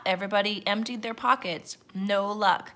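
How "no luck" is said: ordinary delivery, nothing marked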